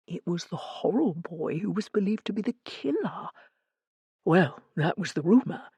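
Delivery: hushed, confiding gossip; conspiratorial. A narrator's reading. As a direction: drop the volume and share a dark rumour. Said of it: "laid a conspiratorial hand on my arm".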